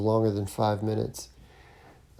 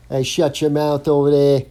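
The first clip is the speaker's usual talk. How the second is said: loud, higher northeast Boston heckle; mock Boston accent; 4 semitones higher, 7 dB louder than usual. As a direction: bark it like a Boston comic yelling at a heckler. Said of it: "that northern, uh, northeast accent up there calling your names".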